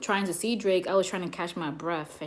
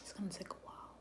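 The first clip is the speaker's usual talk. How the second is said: whispered; 17 dB quieter, faster than usual. Shown as a demonstration. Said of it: "reading to viewer".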